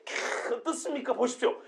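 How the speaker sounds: soft, breathy sightseeing admiration; hushed wow at the building; admiring sigh. Shown as a demonstration. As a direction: softly marvel at the view. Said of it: "이렇게 해석이 됐죠".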